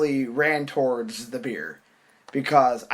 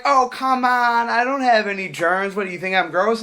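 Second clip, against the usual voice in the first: mock coaxing; 6 semitones higher than usual. Girl pushing her drink on him, mimicked coaxing. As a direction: imitate a pushy girl wheedling.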